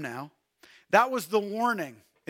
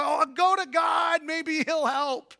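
a hint of mock resignation, higher; desperate afterthought; 7 semitones higher than usual. Preacher mocking people who turn to God only at last. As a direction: sigh it out as a last resort. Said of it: "and finally".

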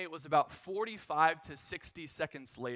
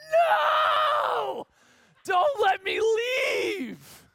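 much higher, louder, drawn-out cry; hammed-up desperate scream; 9 dB louder, much slower than usual. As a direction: beg not to be wheeled out, slowly. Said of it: "I'll just screaming with my arm out".

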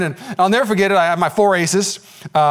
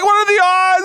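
mock whimper; 14 semitones higher, 4 dB louder than usual. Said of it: "started crying".

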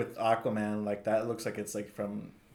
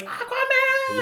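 excited shout; 6 dB louder, much slower than usual. Retold or imitated. Fan yelling at Aquaman cosplayer.

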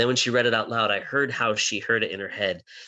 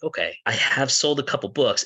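retold retort; 4 semitones higher than usual. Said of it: "And I'm like".

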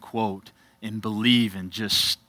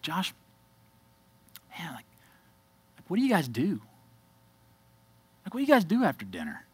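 softer and tentative, asking a friend at dinner; hesitant quiet question; 5 semitones higher, 6 dB quieter, faster than usual. Retold or imitated. Pastor retelling asking his friend at dinner, softly and hesitantly. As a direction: ask it sheepishly, almost under your breath. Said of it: "I said".

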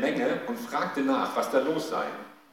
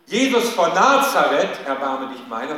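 a loud called name, then easing; imagined cry; 9 dB louder than usual. Retold or imitated. Preacher showing the cry the beggar did not make, loud call. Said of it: "Aber der Blinde ruft nicht".

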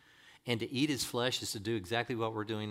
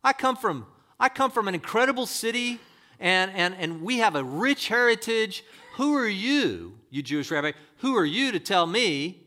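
loud, high indignant outburst; acting out an affronted woman puffed up with pride; offended; 9 semitones higher, 10 dB louder than usual. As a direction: bellow it as a mock outraged retort. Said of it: "She was just offended".

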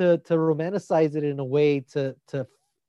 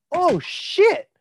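surprised exclamation; 11 semitones higher, 3 dB louder than usual. Retold or imitated. Retold reaction to character appearing.

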